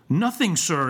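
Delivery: mildly outraged exclamation; exclaimed. A narrator's reading. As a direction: throw it back as an indignant schoolmaster. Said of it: "exclaimed Mister Squeers".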